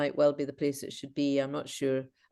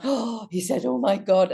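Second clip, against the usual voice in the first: gasp, then a raised mock-amazed exclamation; retold amazement; 6 semitones higher than usual. Retold curator's amazed reaction.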